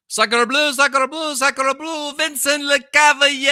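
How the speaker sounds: high, loud mock French hockey call; hammed-up French commentator yelling a goal; mock French announcer. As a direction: shout it like a Quebec play-by-play. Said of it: "a French broadcast of the Montreal Canadiens".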